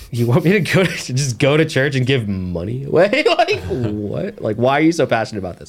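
high-pitched, baffled disbelief breaking into laughter; incredulous, squealed retort with laughs; laughing incredulity. Retold or imitated. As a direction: laugh through an astonished objection, voice climbing high. Retells baffled reaction to the pitch.

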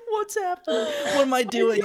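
helpless high-pitched giggling speech; real laughing fit; falling apart laughing. Real, not acted. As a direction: squeeze the words out through uncontrollable laughter. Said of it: "I'm falling apart".

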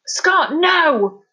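high loud cry of warning; urgent shout from a father figure. A narrator's reading. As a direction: ring out to stop him. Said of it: "Tom's voice rang out in warning".

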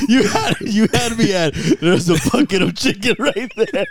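high, laughing quote of the punchline; cracking up quoting the chicken line. Real, not acted. Laughing while quoting friend.

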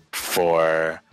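usual speaking voice

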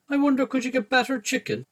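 lightly voiced Stacey, curious and high; Stacey's voice. Sister musing about battered chicken at dinner, high curious voice.

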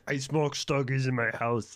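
mock Austrian strongman accent, drawn out; Arnold Schwarzenegger. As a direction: put on a thick Austrian action-hero accent. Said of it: "that's my Arnold Schwarzenegger impression".

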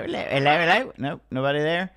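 ordinary delivery

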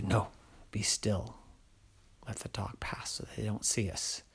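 whispering to hide; hiding whisper. Acted. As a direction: keep still and whisper. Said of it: "whisper heard; hiding from dog".

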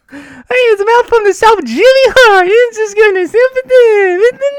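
very high, loud, hammed-up wrestling manager voice; over-the-top high-pitched hype man. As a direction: squeal it out like a Southern wrestling manager. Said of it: "do an impression of, uh, [name]".

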